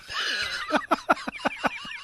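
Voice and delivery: shrieking high laugh; high burst of laughter. Real, not acted. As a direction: let out a high squealing laugh. Laughing at shrimp finger joke.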